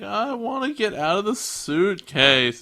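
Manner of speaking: put-on stretched-out childish whine; whiny creature. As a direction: drag it out like a bored pet. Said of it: "Gilmon's like".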